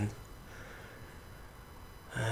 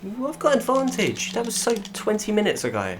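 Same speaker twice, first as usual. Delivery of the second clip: sarcastic; 11 semitones higher, 3 dB louder than usual. Real, not acted. Mocking game advantage call.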